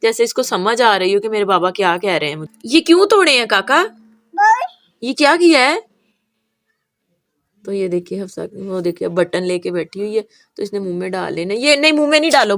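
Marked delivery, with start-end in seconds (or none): 2.7-5.8 mock scolding. Real, not acted. Mother playfully scolding baby over broken earphones, raised voice. 11.6-12.6 quick warning. Real, not acted. Mother stopping baby putting a button in her mouth, quick raised warning.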